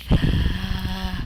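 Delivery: creepy rasping grunt; creepy croak. Acted. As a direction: croak it eerily. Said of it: "that was a creepy noise".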